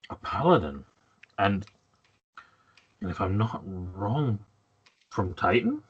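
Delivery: lightly voiced lord, quiet and halting; measured noble questioning a visitor, with long pauses; weary nobleman. Worn noble asking the paladin's origin, quietly halting.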